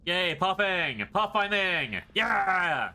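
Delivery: loud, high, drawn-out cheering; excited cheer. Real, not acted. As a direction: whoop it up like a win. Cheering after solving puzzle.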